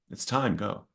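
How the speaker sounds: soft, voiced imitation of a whisper; retold inner whisper. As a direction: quietly echo the voice in the heart. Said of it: "the exact same voice just repeat itself".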